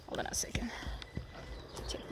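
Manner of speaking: breathy whispered aside. Real, not acted. Rider whispering to the camera so the horse stays calm.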